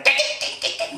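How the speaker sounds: imitated rapid dog yelping; wounded puppy yelps. Preacher showing a hurt puppy being touched, yelping. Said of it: "상처받은 강아지 같아요".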